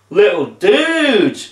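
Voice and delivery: high, long-drawn laid-back greeting; beaming zombie dude. A narrator's reading. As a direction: stretch it out like a happy surfer dude. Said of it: "he beamed".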